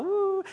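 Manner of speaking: a silly high whoop poking fun; mock high woo. Preacher mocking a sweet affectionate man, high mock woo.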